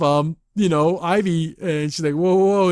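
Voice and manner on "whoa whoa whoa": louder, higher startled whoa. Retold or imitated. Ivy dodges a sudden attack, a loud startled whoa. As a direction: jump back in alarm. Dodging a thrown hat.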